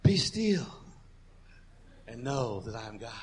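mock soft preacher voice, lightly done; a gentle, hushed imitation of Pastor Joel. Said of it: "get your best Pastor Joel voice out".

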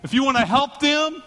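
loud, high, slow, fiery preaching; preaching at full force; protective preaching. Real, not acted. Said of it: "preaching fervor".

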